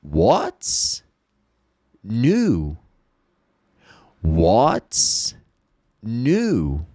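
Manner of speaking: What's new split word by word with pauses; repeat-after-me. Shown as a demonstration. Teacher leading students in repeating aloud. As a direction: say it slowly, pausing between words. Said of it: "Speak aloud".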